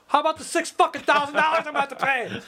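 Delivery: high, strained mock outrage; indignant self. Retelling his own protest at the town meeting, high indignant. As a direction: pitch it up in exasperated protest. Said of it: "I'm the only one going".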